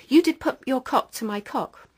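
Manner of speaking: put-on high, loud childlike accusation; child accusing. Little girl accusing the lodger, high and loud.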